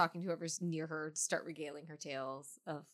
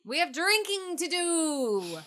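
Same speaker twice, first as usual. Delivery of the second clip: high, drawn-out singsong holler; hearty, stretched-out summons to drink; rallying call; 10 semitones higher, 7 dB louder, slower than usual. Acted. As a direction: call it out slow, loud and high. Rousing a friend to go drinking.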